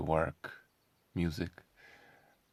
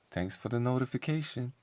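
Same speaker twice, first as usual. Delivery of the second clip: ordinary talk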